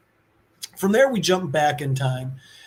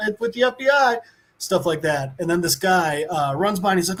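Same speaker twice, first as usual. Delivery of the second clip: normal talking voice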